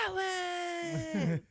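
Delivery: high, drawn-out battle cry; loud as possible. Retold or imitated. Costumed guy leaping up before running away, all-out shout. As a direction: bellow it like a costumed superhero taking off. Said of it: "it's just as loud as he can".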